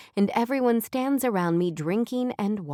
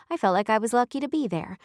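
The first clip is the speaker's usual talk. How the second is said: ordinary delivery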